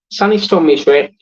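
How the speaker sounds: ordinary talk, nothing marked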